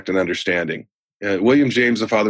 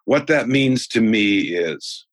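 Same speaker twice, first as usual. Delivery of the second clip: slowed right down, each word set apart; teaching the six magic words, slow; slow emphatic phrase; much slower than usual. Shown as a demonstration. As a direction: spell out the magic words slowly. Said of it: "Those are the six words".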